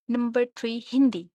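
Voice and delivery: ordinary talk, nothing marked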